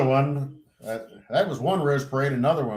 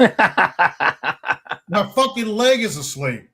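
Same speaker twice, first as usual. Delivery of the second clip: high, drawn-out complaint with a laugh; amused, exaggerated exasperation; mock protest; 7 semitones higher, 3 dB louder, much slower than usual. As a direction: replay the protest slowly for laughs. Repeats his protest over laughter.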